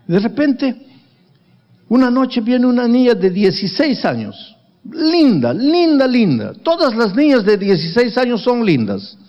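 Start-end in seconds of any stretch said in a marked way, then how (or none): 4.9-6.5 emphatic praise. Real, not acted. Pastor praising the girl.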